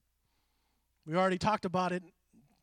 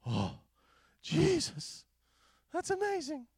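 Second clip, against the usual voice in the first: mock awe; 9 semitones higher, slower than usual. Mock awe at simple lyrics.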